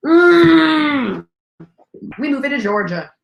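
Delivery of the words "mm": loud savoring mmm. Real, not acted. Loud tasting sound, sustained.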